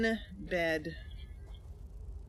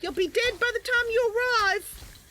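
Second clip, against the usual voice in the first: much higher, louder mocking threat; taunting character voice; 6 dB louder than usual. Player voicing Mercy threatening enemies, high taunting voice. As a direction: sneer at the enemy from up high.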